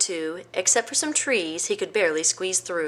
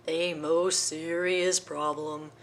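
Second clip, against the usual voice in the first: slow, soft, grave elephant; solemn elephant; 2 semitones lower, 4 dB quieter, slower than usual. Acted. Elephant studying Hubert's head, slow and grave. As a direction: pronounce it gravely.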